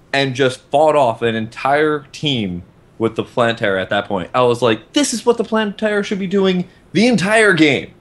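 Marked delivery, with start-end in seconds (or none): 4.9-7.9 high-pitched, emphatic outburst; worked-up, pitched well above normal talk; excited exclamation. Retold or imitated. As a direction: exclaim it like an amazed spectator. Acted excited reaction to the play.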